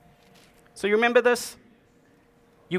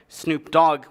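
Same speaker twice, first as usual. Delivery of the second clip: ordinary delivery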